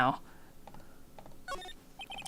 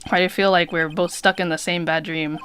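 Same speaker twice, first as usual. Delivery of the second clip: normal talking voice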